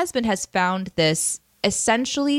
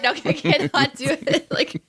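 real laugh through the words; cracking up mid sentence; laughing too hard to go on; 6 semitones higher than usual. Real, not acted. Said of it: "I started laughing".

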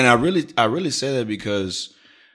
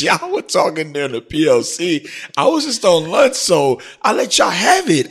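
laid-back comic self; 9 semitones higher, 4 dB louder than usual. Retold or imitated. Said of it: "I said to her, I was like".

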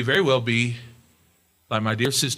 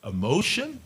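friend's advice; 7 semitones higher, 3 dB louder, much slower than usual. Retold or imitated. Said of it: "He'd say".

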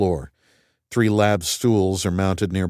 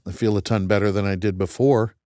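plain reading voice

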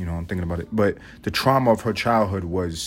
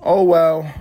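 high, careless shrug of a voice; indifferent grandma; 10 semitones higher, 5 dB louder, much slower than usual. Retold or imitated. Grandma dismissing her dead cat, high and offhand. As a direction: brush it off like it's nothing. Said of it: "She went".

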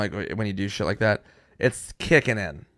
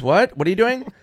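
high, loud suspicious mother; mom questioning; 10 semitones higher, 6 dB louder than usual. Retold or imitated. Mom questioning her laughing son. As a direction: ask it like a puzzled mom.

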